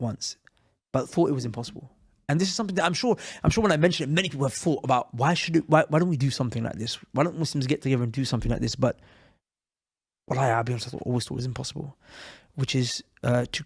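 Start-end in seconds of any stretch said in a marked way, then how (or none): none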